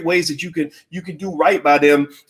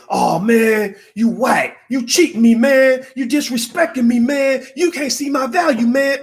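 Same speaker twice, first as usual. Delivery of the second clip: mock indignant; 7 semitones higher, slower than usual. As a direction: whine like a hurt salesman who got turned down. Rejected seller complaining to buyer.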